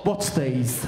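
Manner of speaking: low, smug wife's voice; smug missus. Retold or imitated. Wife finds the underpants instantly, low smug voice. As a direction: pull them out with a smug low drawl. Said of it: "They just stick their hand in and go".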